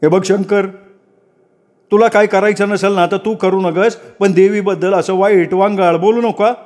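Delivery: lightly voiced, scolding villager; indignant devout villager. Villager scolds Shankar over goddess. (चिडून समजावणारा गावकरी)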